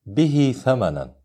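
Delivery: "به" lengthened to bihee before "ثمنا"; short connecting madd. Shown as a demonstration. Teacher reads a minor connection example, "به" with lengthened ending. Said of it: "هو صلة صغرى".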